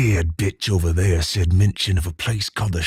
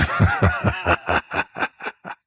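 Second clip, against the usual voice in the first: low breathy gloating chuckle; villain chuckle; 5 semitones lower than usual. Acted. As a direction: laugh it off under your breath, smug. Villain gloating over kill.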